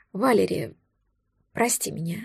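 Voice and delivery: soft, whispered male apology; sighed apology. A narrator's reading. Dragon whispers his apology to Valeria, sighing. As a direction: sigh it out, barely voiced. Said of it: "вздохнул он".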